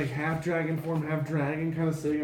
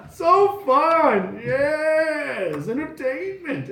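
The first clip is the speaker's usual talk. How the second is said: high, gleeful villain voice; cackle, then savor each word; hammed-up delighted tyrant; mad dragon king; 10 semitones higher, 5 dB louder, much slower than usual.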